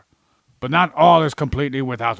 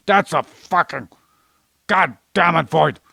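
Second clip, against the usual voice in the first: louder, higher outburst of frustration; exasperated swearing; 5 semitones higher, 6 dB louder than usual. Acted. Ranting to Void about blindly believing books, exasperated. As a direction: curse emphatically at the whole idea. Rant about not questioning things.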